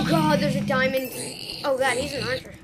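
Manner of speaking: loud startled exclaim; alarmed exclaim. Real, not acted. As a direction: caught off guard. Sudden finds.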